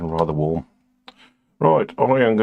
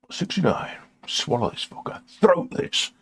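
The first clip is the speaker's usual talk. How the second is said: softer, breathy whispered smut; naughty whisper; 6 semitones higher, 4 dB quieter than usual. Acted. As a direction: whisper it like a dirty secret. Crude joke whispered.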